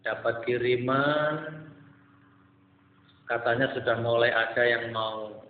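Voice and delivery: ordinary delivery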